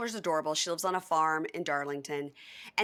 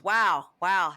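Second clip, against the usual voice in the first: loud; 8 dB louder than usual. Retold or imitated. Acting out stunned admiration.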